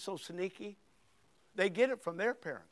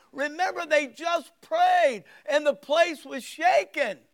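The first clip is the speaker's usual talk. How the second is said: loud, fervent pulpit preaching; 5 semitones higher, 6 dB louder than usual. Real, not acted. Pastor preaches Acts to the congregation, fervent loud preaching. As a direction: preach it with full force.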